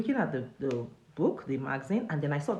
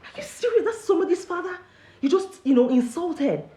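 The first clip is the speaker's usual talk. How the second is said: retold outrage, pitched much higher and louder; shocked outrage; 12 semitones higher, 5 dB louder than usual. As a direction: voice shooting up in disbelief at the insult. Outraged at the warder's rudeness.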